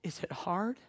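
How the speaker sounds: put-on whispered child's question. Girl quietly asking the pastor after service, whispered. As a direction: breathe the question with no voice.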